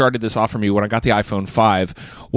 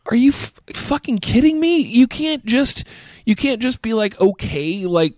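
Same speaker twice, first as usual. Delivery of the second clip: high, disbelieving rant; pitched way up in outrage; exasperated outburst; 11 semitones higher than usual. Retold or imitated. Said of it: "And I'm just like".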